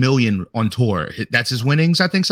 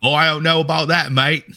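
higher, hammed-up Aussie accent; Australian accent attempt; 4 semitones higher than usual. Shown as a demonstration. Challenged co-host showing his version, shaky Australian accent. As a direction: have a rough go at Australian, veering British. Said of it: "You give it a go".

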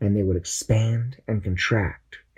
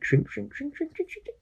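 fading shrink; 7 dB quieter than usual. A narrator's reading.

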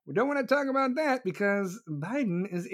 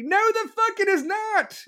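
angry denial; 12 semitones higher, 4 dB louder than usual. Real, not acted. Ranting rebuttal.

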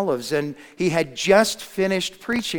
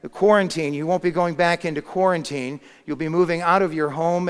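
ordinary talk

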